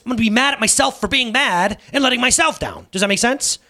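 normal talking voice

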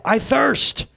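high, anguished cry. A narrator's reading. Jesus on the cross, body on fire, crying out. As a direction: cry it out with a big leap in pitch. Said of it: "I heard myself cry out".

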